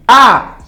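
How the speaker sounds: high, loud cry of dismay; real loud groan of shame; loud wail. Real, not acted. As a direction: let out an anguished ah. Reacts to the disgrace.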